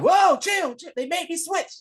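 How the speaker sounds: high panicked yell to stop the shooting; panicked shout. Retold or imitated. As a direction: yell high, hands up, begging him to hold fire. Oz stopping a gunman.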